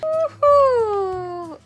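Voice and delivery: usual speaking voice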